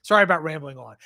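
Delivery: normal talking voice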